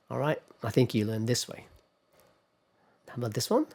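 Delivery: normal talking voice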